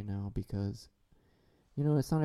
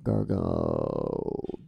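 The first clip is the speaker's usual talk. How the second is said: gargle. Pretend. Gargling joke.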